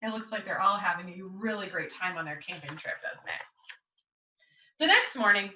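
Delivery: ordinary delivery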